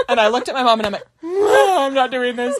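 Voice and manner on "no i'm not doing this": crying no. Retold or imitated. Retold panic before a painful shot.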